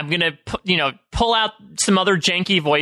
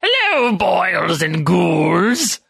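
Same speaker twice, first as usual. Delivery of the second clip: Crypt Keeper voice; much slower than usual. Acted. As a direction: drawl it like a horror host. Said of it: "went full Crypt Keeper on me".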